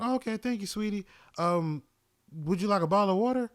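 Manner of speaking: chirpy, high friendly customer greeting; high, sugary older lady saying hello. Retold or imitated.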